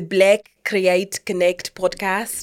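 slow, careful South African accent. Shown as a demonstration. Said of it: "South African accent".

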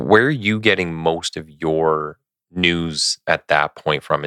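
ordinary talk

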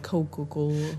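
muffled Coca-Cola. Shown as a demonstration. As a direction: mumble it softly. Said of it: "muffling my voice".

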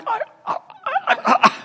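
very high, broken stammering; speechless stammer. Retold or imitated. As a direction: stammer stunned, lost for words. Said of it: "he's going like".

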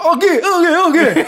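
high, loud swollen-tongue cries; panicked calling. Retold or imitated. Man waking his friend in anguish, panicked garbled yelling. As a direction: frantically shout someone awake. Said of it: "har sån ångest".

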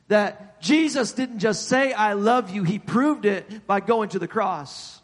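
emphatic preacher with punched key words; stirred-up sermon delivery; earnest preaching. Real, not acted. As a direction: drive the point home from the pulpit. Preaching with force.

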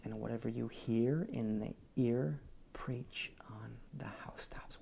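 soft unvoiced whisper; hushed whispered scripture. Shown as a demonstration. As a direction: whisper it like a secret told in the dark. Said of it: "why am I whispering".

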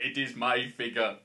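slow, loud action-star impression; Arnie. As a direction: drag out each word in a put-on voice. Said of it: "Deck the Halls with Arnie".

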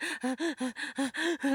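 a quick mock sob-gasp, unable to get a full breath; half-laughing demo of sobbing without air; imitated panicked crying. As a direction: act out the breathless crying briefly. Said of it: "that's how I was crying".

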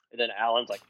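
ordinary talk

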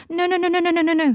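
high, loud rapid-fire refusal; frantic denial. Acted. Draco refusing to hear Michael, frantic and high. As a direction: stream of high panicked noes.

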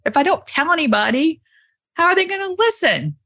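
mock self-scolding. Telling the host how she argued with herself, mock exasperated. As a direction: scold yourself in mock disbelief. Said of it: "I was like".

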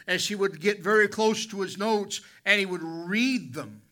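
loud, high fiery sermon voice; full-force preaching. Acted. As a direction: preach it hard to the pews.

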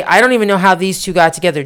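ordinary delivery

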